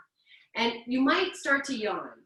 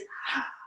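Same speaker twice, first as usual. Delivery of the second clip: breathy yawn sigh; 5 dB quieter than usual. Shown as a demonstration. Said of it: "calling in breath with a wide open mouth".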